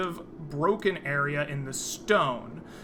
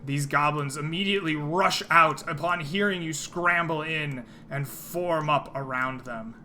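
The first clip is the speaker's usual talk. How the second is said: ordinary delivery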